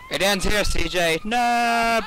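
loud, high dramatic yell; dramatic shout. Real, not acted. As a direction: shout like a showdown. Dramatic in-game moment.

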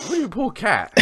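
high-pitched outraged comeback; indignant disbelief. Real, not acted. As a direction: throw the sympathy back in disbelief. Outraged at friend's sympathy for cat.

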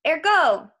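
high, loud magic word; spring the trap with one word; triumphant command. Acted. Percy turning the ropes on Krusty, loud command.

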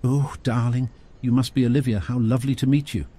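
a lightly voiced gushing queenly lady. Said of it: "waved her hands around like a queen".

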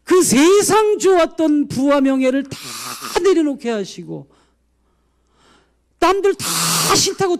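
anguished prayer rising to a cry; wailing lament. Retold or imitated. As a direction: pour out the complaint as if weeping.

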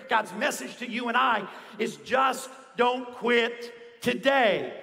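rousing preacher building with conviction; impassioned preaching. Real, not acted. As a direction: drive it home to the congregation, punching key words. Preaches at full force.

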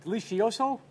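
Spanish-accented delicioso, lightly played; mock Peruvian Spanish. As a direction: say delicioso like a Peruvian would, half joking. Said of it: "Or as they say in Peru".

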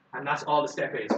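normal talking voice

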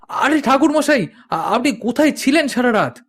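surprised call. Acted. Bibhuti calls to the returning priest in surprise. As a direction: hail him from a distance, startled.

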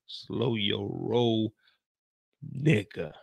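very slow, drawn-out warning; slow it right down, low-key and deliberate. Acted. Repeated warning to Kane, slowing down.

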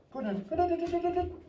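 very high squeaky praise to a dog; excited dog praise. Real, not acted. Owner cheering his dog carrying the dummy back, very high squeaky praise.

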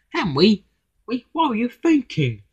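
high-pitched incredulous question; astonished. A narrator's reading. James questions Henry about confronting the director, astonished.

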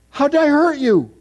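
high, loud, disbelieving; shocked question. Retold or imitated. Stunned young man asking his friend, high and loud. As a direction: ask it as if stunned.

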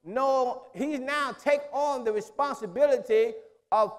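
high, loud, rolling preacher's cadence; full-force preaching. Acted. Preacher pressing the church on the Spirit, full-force preaching.

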